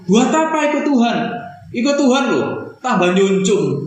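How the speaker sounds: high, scornful taunt; mocking jeer. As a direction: sneer at the believer. Voicing mockers of one's faith. (ejekan sinis bernada tinggi)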